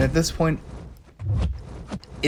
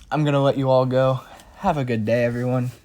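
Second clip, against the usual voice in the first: usual speaking voice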